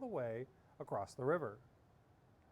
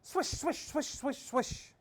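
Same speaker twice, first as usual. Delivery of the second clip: quick swishes; 12 semitones higher, 5 dB louder, slower than usual. A narrator's reading. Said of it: "went in fast circles".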